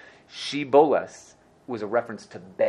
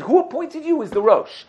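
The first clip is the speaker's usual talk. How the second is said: indignant challenge; 6 semitones higher, 3 dB louder than usual. Acted. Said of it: "they went up to him and said".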